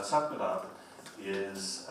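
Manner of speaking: usual speaking voice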